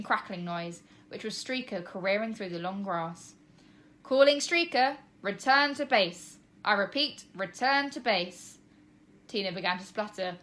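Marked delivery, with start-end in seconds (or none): 4.0-8.6 radio call. A narrator's reading. Trev radios the dog like pilot.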